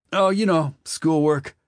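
put-on high, loud young boy voice; teen boy voice. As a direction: answer like a cagey teenager. Wesley answers, high voice.

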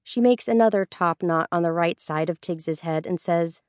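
usual speaking voice

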